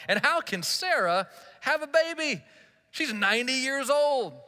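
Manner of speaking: acted-out incredulity; disbelief. A narrator's reading. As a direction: voice Abraham's disbelief, pitch jumping up. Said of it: "laughed to himself in disbelief".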